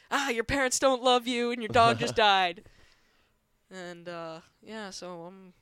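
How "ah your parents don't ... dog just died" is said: louder, higher, gleefully mean delivery; mock taunt. Former kid needling a classmate in front of everyone, loud mock taunting. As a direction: pile on the fake compliment as a loud taunt.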